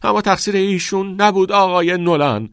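high, loud pleading defence; persistent protest. A narrator's reading. Todd defends Keating to Nolan, persistent high protest. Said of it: "تاد که دست بردار نبود گفت".

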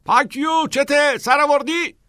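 very high, loud heckle; yelling back. A narrator's reading. Voice from the end of the hallway answers Neil, yelling. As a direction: yell it down the corridor at a noisy friend.

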